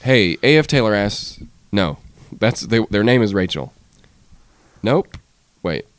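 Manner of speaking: usual speaking voice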